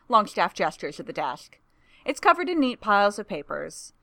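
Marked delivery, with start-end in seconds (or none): none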